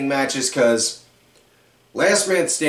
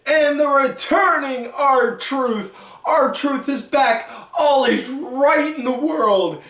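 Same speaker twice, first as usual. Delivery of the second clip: high, slow, gushing welcome with a laugh in it; hammed-up excitement, much higher; over-the-top hype; 9 semitones higher than usual. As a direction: hype the return like a giddy fan. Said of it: "My best bad impression of R-Truth".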